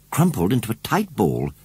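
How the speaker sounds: plain reading voice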